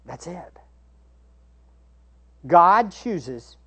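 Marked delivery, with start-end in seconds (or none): 0.1-0.6 whisper. Real, not acted. Whispered conclusion.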